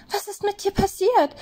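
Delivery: high, shocked rabbit cry; horrified at what he sees; aghast high rabbit voice. A narrator's reading. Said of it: "fragte er entsetzt".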